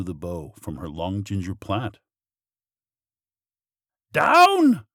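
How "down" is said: very high, loud command; storybook witch crying an order; witch's cry. A narrator's reading. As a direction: call out sharply in a high witch voice. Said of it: "cried the witch".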